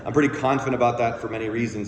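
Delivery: normal talking voice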